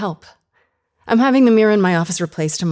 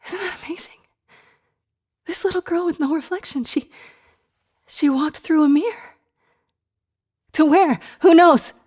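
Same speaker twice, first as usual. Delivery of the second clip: higher, thrilled wonder; 8 semitones higher than usual. Acted. Telling her colleague about the recording, thrilled. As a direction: marvel at it, getting carried away.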